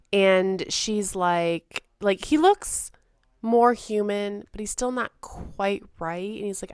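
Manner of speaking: ordinary delivery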